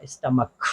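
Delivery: "स्टमक" with a hard k sound for the ch. Shown as a demonstration. Teacher showing students how "स्टमक" ends in a k sound. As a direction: say "स्टमक" ending in k, never ch. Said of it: "उसका जो उच्चारण है वो क हो जाएगा".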